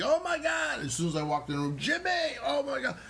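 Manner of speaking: high, gushing fan voice with a rush of praise; gushing excitement. Retold or imitated. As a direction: imitate an overjoyed director raving about the audition. And he's like, Jason gushing.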